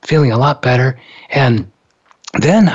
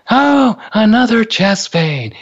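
drawn-out, higher-pitched "here we go" groan; exasperated eye-roll; 9 semitones higher, slower than usual. Retold or imitated. Triage nurse sighing over yet another heart patient, exasperated. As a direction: imitate a jaded nurse, slowly and higher. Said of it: "she rolls her eyes".

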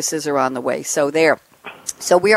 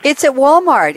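excited; 7 semitones higher, 5 dB louder than usual. Real, not acted. Excited find while searching.

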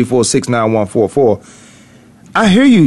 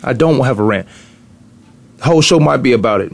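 ordinary delivery

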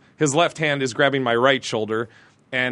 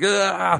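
higher-pitched mock charging "ugh"; imitated charging grunt; 6 semitones higher than usual. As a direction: grunt like you're bulldozing someone, imitating him. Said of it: "like, bulldoze, ... He's like".

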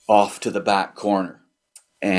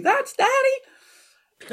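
put-on drawn-out, high childish cry; retold yell; much slower than usual. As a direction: stretch it out like the boy shouting in the hall. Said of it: "yelled out in silence to everybody".

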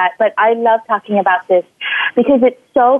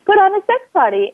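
"Put on a sex party" said much higher, bright and eager; excited announcement; 10 semitones higher than usual. Telling her husband the birthday plan, high and excited. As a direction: blurt it out, thrilled. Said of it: "And I said".

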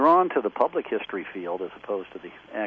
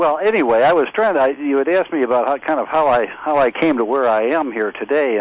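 louder, pushing voice into the phone; raised voice; 5 semitones higher, 6 dB louder than usual. Real, not acted. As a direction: speak up over a bad line. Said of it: "just keep shouting into the phone".

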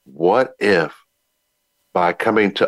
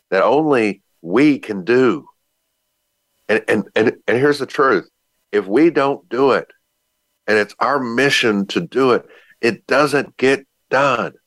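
preaching; 6 semitones higher than usual. Acted. Builds to preaching intensity.

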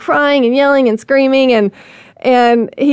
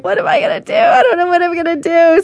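panicked; 7 semitones higher, faster than usual. Retold or imitated. Panicking hiding in car.

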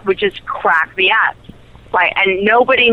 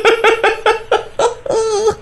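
high laughter. Real, not acted. Laughing at own story.

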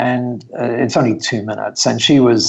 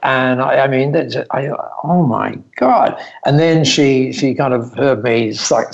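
ordinary delivery

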